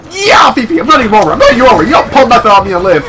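loud, high yelling at a victim; gleeful yell. Real, not acted. Player yelling at a game character while running him over, loud and high. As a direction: roar it out while driving over them.

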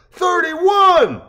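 high, loud shout; crowd declares. Acted. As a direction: call it out like a rally crowd. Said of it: "the crowd declares".

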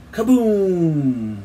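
high, loud falling boom; long cliffhanger blast; drawn-out kaboom tailing off; bomb explosion. A narrator's reading. Final bomb blast in the story.

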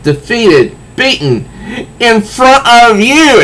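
lightly voiced wounded villain; a hint of wounded pride; humiliated Riddler. Riddler confessing defeat to the Joker, lightly voiced. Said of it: "the Riddler gets up and says".

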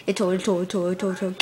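low, soft looping stammer; stuck repeating. Acted. Luigi stalling over the newspaper news, stammering repeatedly. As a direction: get stuck like a skipping record.

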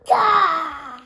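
put-on loud toddler dinosaur roar; baby dinosaur roar. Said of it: "아기 공룡".

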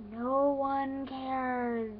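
very slow, stretched-out words; deadpan dismissal. Acted. Older cousin dismissing a child's fake cry, slow and deliberate. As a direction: drag out each word to shut her down. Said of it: "I look over to her and I go".

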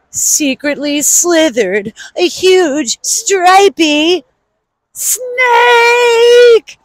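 slow, rising to a high snake; suspenseful storytime reveal; slow suspense build. A narrator's reading. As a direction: draw it out for a creepy reveal.

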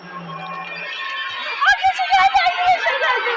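high, loud panicked shriek breaking into a laugh; terrified scream. Real, not acted. Gamer shrieking to viewers as Granny appears, terrified. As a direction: scream it as the monster lunges in.

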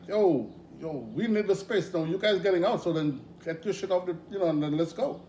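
loud, high call to the crew. Retold or imitated. Telling the band crew to clear out, loud call. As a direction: hurry the crew out, loud and pushy. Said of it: "And I'm like".

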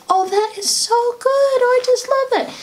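put-on high-pitched, delighted praise for a child; gushing encouragement. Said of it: "Give them lots and lots of encouragement and kudos".

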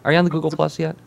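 bright, high announcer outro; upbeat show host wrap-up; radio host sign-off. Real, not acted.

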